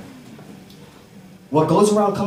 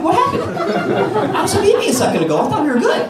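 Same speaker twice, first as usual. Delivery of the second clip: hurt surprise; 3 dB louder than usual. Retold or imitated. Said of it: "I'm like".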